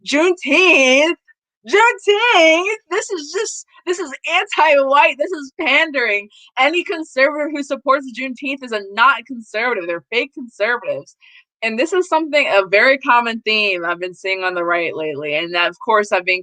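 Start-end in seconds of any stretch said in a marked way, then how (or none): 0.0-11.2 mocking Charlie Kirk. Imitating Charlie Kirk's outrage at Juneteenth, high mocking whine. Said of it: "And this year he's like".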